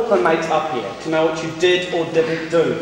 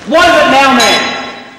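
loud, higher, impatient; impatient snap; 4 semitones higher, 7 dB louder, slower than usual. Acted. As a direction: brush him off with irritation. Officer snaps at corporal.

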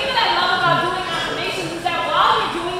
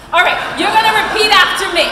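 calling out; 5 semitones higher, 7 dB louder than usual. Real, not acted. Calling crowd to repeat.

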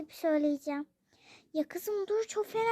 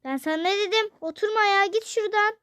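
louder, sharper scolding; angry scolding; 3 semitones higher, 5 dB louder, faster than usual. Acted. Father angrily shooing his daughter away. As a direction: drive her away with anger.

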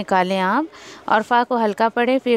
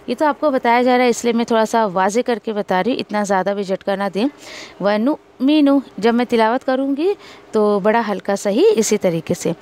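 normal talking voice

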